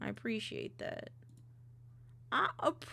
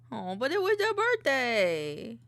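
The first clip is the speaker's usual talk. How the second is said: surprised; 3 semitones higher, 9 dB louder than usual. Real, not acted. Surprise at birthday news.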